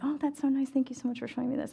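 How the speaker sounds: high, fast, polite gushing; imitating herself gushing politely at the vendor; overly polite. To the shop vendor, retelling her fake-polite thanks, high and fast. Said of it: "trying to be really polite".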